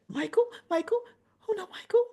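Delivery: high, pleading mock Portia; lightly voiced distraught Portia; Portia's plea. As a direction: beg like a worried mother. Portia voiced in soap recap.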